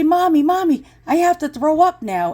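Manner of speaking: put-on high-pitched carsick kid calling Mommy; pleading child. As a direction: imitate a queasy child pleading. Voices herself as carsick child.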